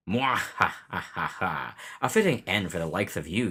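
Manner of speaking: loud, cackling evil lord; cackle, then sneer down at him; gloating villain. Acted. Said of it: "That's pretty good".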